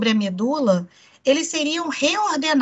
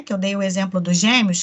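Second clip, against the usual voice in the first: usual speaking voice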